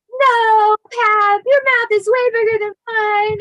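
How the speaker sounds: put-on high, loud child's protest; little girl protest. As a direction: insist like a giggling kid. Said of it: "I'd be like".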